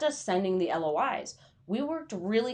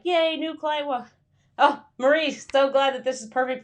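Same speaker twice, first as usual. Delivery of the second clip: mock cheer; 6 semitones higher, 4 dB louder than usual. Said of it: "you're like".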